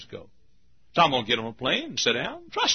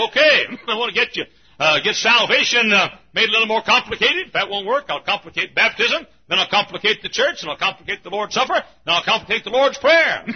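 high, loud gleeful devil plotting; the devil gloating over his plan; scheming devil; 9 semitones higher, 5 dB louder than usual. Acted. Preacher voicing the devil plotting, loud and high. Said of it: "And so the devil says".

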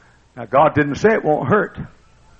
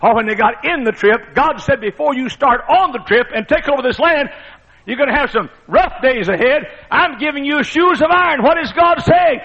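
high, loud preacher's climb; preaching at full force; 12 semitones higher, 5 dB louder than usual. Acted. Preacher rousing the congregation, loud and high. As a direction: preach it out, voice rising to a shout.

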